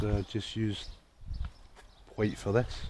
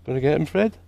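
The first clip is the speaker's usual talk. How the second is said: high coaxing voice; 8 semitones higher than usual. Real, not acted. Egging on the cat.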